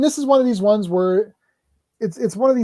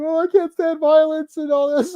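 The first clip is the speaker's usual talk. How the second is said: high, put-on whiny voice; hammed-up fragile actor; mock squeamish hero; 10 semitones higher, 5 dB louder than usual. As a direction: whimper it like a coward. Voicing squeamish Christopher Reeve character.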